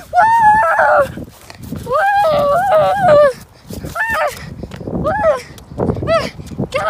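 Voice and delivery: high, loud "woo" and "ah" bursts; loud play-yelling; playful whoops and screams. Pretend. Shouting while playing.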